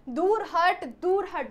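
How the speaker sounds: sharp angry order to get away; angry command. Acted. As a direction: snap it at her in anger. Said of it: "कहा कैकई से सक्रोधित".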